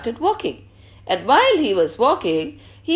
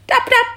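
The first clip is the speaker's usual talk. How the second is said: high, bright tapping noise; tap-tap said like a knock; tap sound; 11 semitones higher, 4 dB louder than usual. A narrator's reading. Storyteller making shoulder-tap sound, high imitation. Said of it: "somebody tapped on his shoulder".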